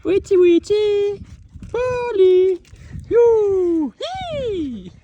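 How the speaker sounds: squeaky falsetto nonsense call, slowed; silly high voice. Pretend. Goofing around on the castle ruins in a squeaky falsetto. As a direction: sing out high like a cartoon bird.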